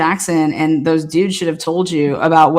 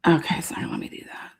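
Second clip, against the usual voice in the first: fast, breathless whisper to himself; whispered aside; 10 dB quieter, faster than usual. Real, not acted. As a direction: mutter it under your breath, quick. Whispered aside while fumbling.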